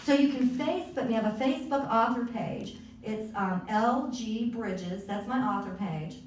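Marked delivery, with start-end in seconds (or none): 3.7-5.0 L G Bridges, each letter slow and clear; spelled out slowly. Shown as a demonstration. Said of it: "spells out her author page".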